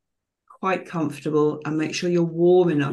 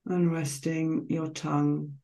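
slow, calm meditation voice with long pauses between phrases; guided meditation; slower than usual. Real, not acted. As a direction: guide listeners gently into relaxation, phrase by unhurried phrase.

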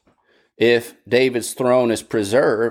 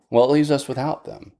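usual speaking voice

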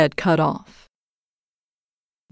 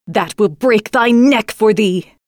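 terrible passion; 3 semitones higher than usual. A narrator's reading. Raging false bride threatens the maid, slow and furious. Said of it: "flew into a terrible passion".

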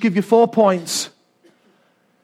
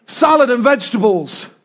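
ordinary talk, nothing marked

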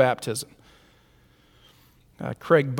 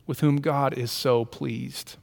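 normal talking voice

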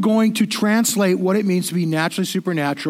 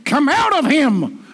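slow, higher, hammed-up command to a demon; mock exorcist shout; 7 semitones higher, 3 dB louder, slower than usual. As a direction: parody an over-the-top deliverance prayer. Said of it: "You don't need to get loud".

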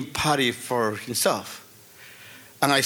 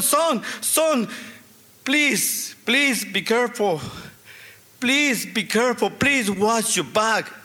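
higher, urgent begging on the phone; anxious pleading; 4 semitones higher, slower than usual. Retold or imitated. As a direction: plead with your son to stay safe. Frightened dad phoning son.

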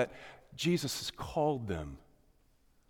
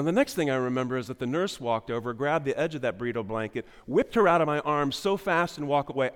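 ordinary delivery

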